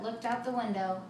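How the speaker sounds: ordinary delivery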